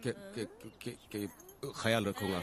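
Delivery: stuck repeating के before ख्याल comes out; stammering. Acted. Groom answers his mother-in-law's question, stammering के before ख्याल. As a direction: stammer on के, के, के, then release ख्याल रखूंगा. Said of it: "ये हकला है".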